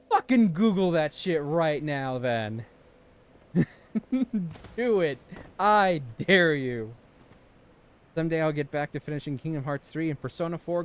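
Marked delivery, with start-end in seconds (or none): 0.1-2.7 louder, playful taunting; hammed-up loud taunt; mock challenge. Mock challenging chat about word. 4.8-6.9 louder, slow playful dare; hammed-up slow taunt; mock dare. Daring chat to look it up.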